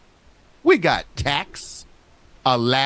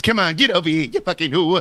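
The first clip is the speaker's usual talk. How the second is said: lightly imitated TV mobster; a hint of a Sopranos tough guy; Ralph Cifaretto. Guessing how the neighbor sounded, light mobster impression. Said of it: "Was it like Ralph Cifaretto from The Sopranos?".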